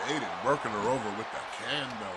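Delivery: excited live play-by-play; wrestling commentator. Acted. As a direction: call the match live. Live play-by-play of ladder match.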